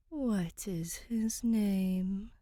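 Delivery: slow, airy whisper from the dark; soundless ghost voice. Acted. City ghost to the visiting princess, a slow spectral whisper. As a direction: breathe it like a thought in someone's head. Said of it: "a shiver in her mind".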